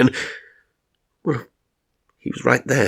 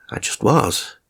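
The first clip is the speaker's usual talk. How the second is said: ordinary delivery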